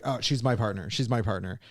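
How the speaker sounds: low, quiet possessive claim; understated jealous prince, low and quiet. Retold or imitated. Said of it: "putting jealous, possessive".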